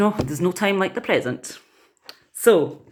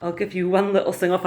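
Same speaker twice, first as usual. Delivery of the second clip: normal talking voice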